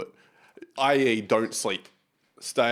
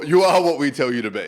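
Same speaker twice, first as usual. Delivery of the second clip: louder, bossy teasing; mock bossy; 4 semitones higher, 8 dB louder than usual. Friend mock-ordering the German backpacker. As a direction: boss him around in jest.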